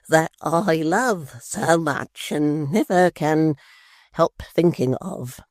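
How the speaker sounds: very slow, syrupy lover's plea; mock tender wooing. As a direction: melt her with sweet talk. Tappertit woos her.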